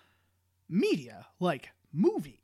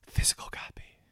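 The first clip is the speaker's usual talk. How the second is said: whisper. Acted. Aside joke about the term.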